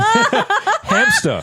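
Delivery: tiny squeaky high critter voice; guinea pig voice. Pretend. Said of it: "Instead of a voice, I could have just done a guinea pig noise".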